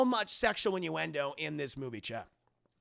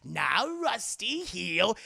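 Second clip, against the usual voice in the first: slow, higher mock-sweet command; theatrical villain scolding a pet; Joker; 3 semitones higher, 4 dB louder, much slower than usual. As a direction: draw out the order like a cartoon villain. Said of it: "And Joker's like".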